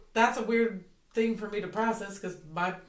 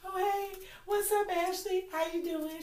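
high, soft cheery greeting; bright phone greeting; 9 semitones higher, 6 dB quieter than usual. Retold or imitated. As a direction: sweeten it up for the phone. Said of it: "I'd be like".

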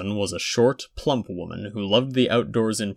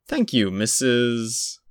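put-on higher, slow polite child; polite boy; 3 semitones higher, 4 dB louder, slower than usual. A narrator's reading. Boy thanking the receptionist, small and polite. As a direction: thank her sweetly as a little boy.

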